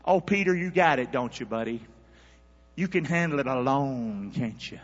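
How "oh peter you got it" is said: ordinary delivery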